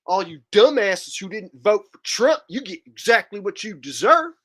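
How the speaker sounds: high-pitched Southern rant, put on; mock a Trump-voting Alabamian with a thick Southern twang; Trumper Southern accent. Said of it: "Trumper Southern accent".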